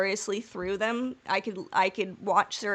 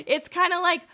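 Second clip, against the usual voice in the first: high laughing aside; 10 semitones higher, 5 dB louder than usual. Real, not acted. Podcaster laughing about not caring about plot holes. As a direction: laugh it out before the point.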